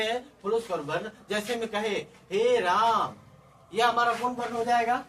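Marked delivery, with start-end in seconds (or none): 2.3-3.1 हे राम called out loud with long-held vowels; prolonged vowel. Shown as a demonstration. Teacher showing प्लुत स्वर, हे राम loud and drawn out. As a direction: stretch the vowels of हे and राम, louder. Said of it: "तीन मात्रा का समय लगता है".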